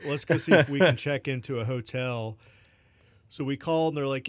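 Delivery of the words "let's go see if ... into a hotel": louder laughing retelling. Retold or imitated. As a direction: laugh through the words. Laughing while quoting.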